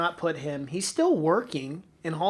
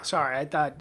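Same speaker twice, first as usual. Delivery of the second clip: usual speaking voice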